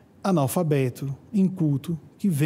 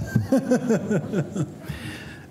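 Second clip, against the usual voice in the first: laugh; 3 semitones higher than usual. Real, not acted. Laughs after his own imitation.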